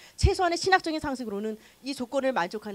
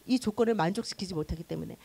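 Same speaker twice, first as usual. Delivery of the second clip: normal talking voice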